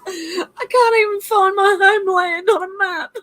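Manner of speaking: high-pitched, squeaking through real laughter; helpless laughter. Real, not acted. Streamer admits poor geography to viewers, helpless with laughter. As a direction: barely get it out for laughing.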